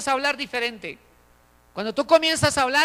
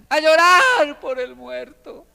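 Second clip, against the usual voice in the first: imitated wailing; 3 semitones higher, 7 dB louder, slower than usual. Preacher imitates hired mourners arriving to weep for the dead, mock wailing. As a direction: burst into a drawn-out imitation wail.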